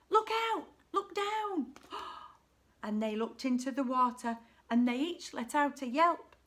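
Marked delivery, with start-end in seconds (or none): 0.1-1.7 high, urgent storybook yell; yelled warning. A narrator's reading. Drip warning his sisters on the bridge, high yell. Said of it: "who yelled". 1.8-2.3 short acted gasp; startled gasp. A narrator's reading. Sisters looking into the water, acted gasp.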